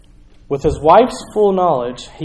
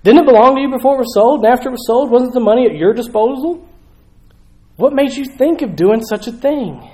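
higher, louder and fast challenge; questioning; 8 semitones higher, 6 dB louder than usual. Retold or imitated. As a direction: press him hard for an answer. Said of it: "he's kinda questioning".

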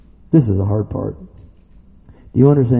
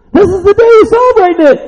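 very high, excited shout from the pulpit; shouted preaching; 10 dB louder than usual. Real, not acted. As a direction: shout the uproar out to the congregation. Preaches at full force.

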